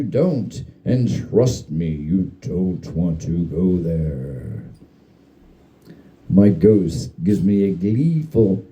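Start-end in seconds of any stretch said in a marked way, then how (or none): 0.9-4.6 low, slow, hushed fatherly warning; ghost's dad impression. Ghost imitating a dad warning the narrator, low and slow. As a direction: drop the voice deep and drag it out like a stern dad. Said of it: "I do a great dad".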